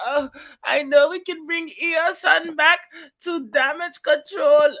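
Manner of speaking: high, lost, squeaky voice; hammed-up confused high voice; mock confused Kairi. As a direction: play her baffled and unsure. Imitating confused Kairi Sane.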